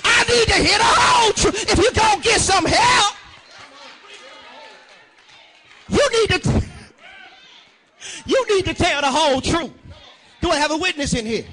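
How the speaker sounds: loud, sung preaching cadence; preaching at full force. Acted. Preacher stirring up his congregation, full-voiced sermon. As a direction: call out the truth to the congregation. Preacher's climax, calling for witness.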